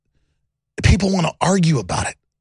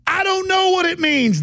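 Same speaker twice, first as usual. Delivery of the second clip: imitating Bolling; 10 semitones higher than usual. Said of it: "He goes".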